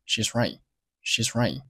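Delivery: "she's right" with the t held back, softly; unreleased final t. Shown as a demonstration. Teacher shows "she's right" to learners, final t unreleased. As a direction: stop "right" before the t pops. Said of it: "爆破音可以不用爆出来".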